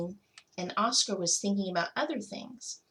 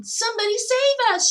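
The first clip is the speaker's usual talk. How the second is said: high, loud panicked shriek; 14 semitones higher, 8 dB louder than usual. A narrator's reading. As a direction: scream for help. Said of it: "shrieked the dogs".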